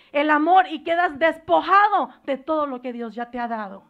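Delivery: loud, driving preacher's cry; preaching at full force. Acted. Pastor warns the congregation of demons, preaching at full force. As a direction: hammer each line home to the church. Preaching against the devil's attacks.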